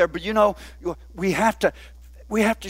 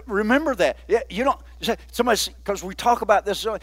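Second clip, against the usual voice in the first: ordinary talk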